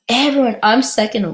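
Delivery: ordinary delivery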